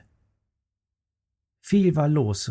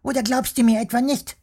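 very high, fast squeaky retort; snapping worm; 11 semitones higher, faster than usual. Acted. Worm snaps at the grinning bear, fast and squeaky. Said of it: "fuhr er ihn an".

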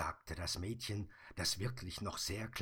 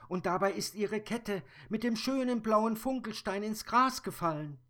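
put-on high, louder child voice; brave little girl; 13 semitones higher, 9 dB louder than usual. Little girl telling the Sandman about the monster, high and loud. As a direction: speak as a bold small girl.

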